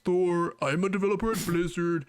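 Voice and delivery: lightly mocked fake voice; a hint of a put-on developer voice; Thor. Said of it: "And that's, it's fake, right?".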